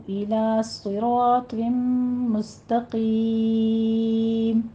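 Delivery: slow, melodic tajweed recitation; measured Quranic recitation. Shown as a demonstration. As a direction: chant the verse slowly with elongated vowels.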